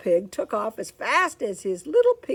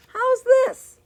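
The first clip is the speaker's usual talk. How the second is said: high illustrator voice; 8 dB louder than usual. Acted. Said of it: "interrupted the illustrator".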